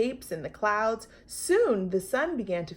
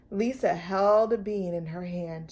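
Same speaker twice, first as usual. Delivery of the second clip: ordinary delivery, nothing marked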